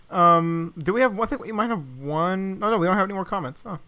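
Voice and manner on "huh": normal talking voice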